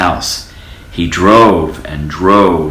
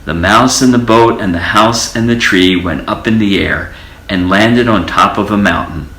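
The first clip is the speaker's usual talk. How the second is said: plain reading voice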